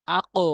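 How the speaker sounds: আকো with the bounce rising upward; আকো with an upward echo; qalqala demo. Shown as a demonstration. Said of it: "আকো বলতে হবে".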